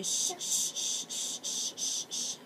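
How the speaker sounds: a gentle unvoiced shh-shh to lull a doll; soft pretend shushing over a toy baby. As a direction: hush the doll to sleep. Lulling the baby doll to sleep.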